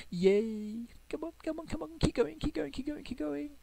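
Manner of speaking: thrilled chant willing the postman to the door; squealing urging. Real, not acted. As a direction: cheer him on down the driveway.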